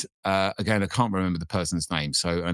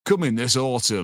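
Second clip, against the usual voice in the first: put-on Yorkshire accent; 4 semitones higher than usual.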